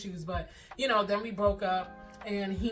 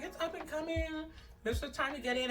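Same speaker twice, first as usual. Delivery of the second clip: higher, upbeat persuading; eager; 8 semitones higher, 3 dB quieter than usual. Retold or imitated. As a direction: sell it like a bargain. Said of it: "but I was like".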